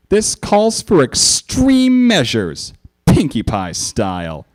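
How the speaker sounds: high, slow dramatic declaration; Pinkie voice. A narrator's reading. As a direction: ham up the extreme measures.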